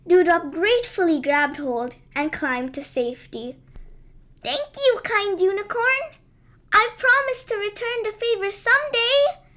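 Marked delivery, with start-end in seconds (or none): 4.4-9.4 high, bright chirping thanks; chirpy fairy voice. Acted. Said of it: "chirped Dewdrop".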